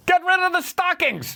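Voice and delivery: high mock shout. Parody president shouting an absurd order, high and mocking. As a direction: bark the order.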